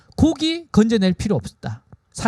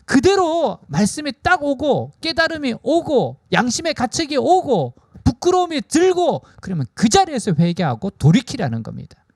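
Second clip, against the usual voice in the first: ordinary talk, nothing marked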